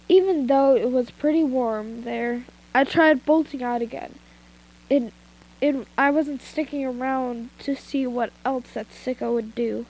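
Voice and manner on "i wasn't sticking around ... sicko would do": usual speaking voice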